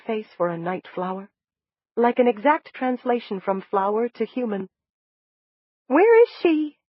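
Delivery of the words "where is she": much higher, louder, demanding; a small voice insisting on an answer; high reedy demand. Acted. New body demanding to know where Pet is, high and reedy. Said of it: "my high, reedy voice demanded".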